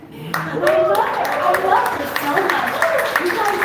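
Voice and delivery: high, loud, overcome with love for mother; emotional outburst. Real, not acted. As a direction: call it out high and loud, full of feeling. Emotional outburst about her mother.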